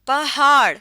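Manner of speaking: पहाड़ with pe and he sounded apart, pa-haar; पहाड़ with separate h. Shown as a demonstration. Teaching Urdu letters, पहाड़ said with the h kept separate. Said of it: "हे अलग बोला".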